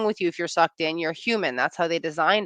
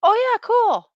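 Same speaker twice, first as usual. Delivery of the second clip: much higher, lightly surprised acknowledgement; partner's light reply; 14 semitones higher, 5 dB louder, slower than usual. Retold or imitated. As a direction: toss back a bright, quick yeah cool. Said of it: "And they go".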